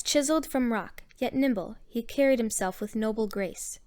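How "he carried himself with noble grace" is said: plain reading voice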